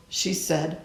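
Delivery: plain reading voice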